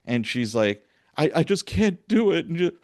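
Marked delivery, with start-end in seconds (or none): 1.2-2.8 imitating a rookie's fake tearful plea, pitched high; whiny put-on near-sob; high, wobbly crocodile-tears voice; mock crying. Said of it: "just the crocodile tears are coming".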